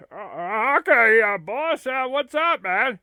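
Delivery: a loud, keen lackey responding; eager sidekick. Acted. Curly Joe answers abbot.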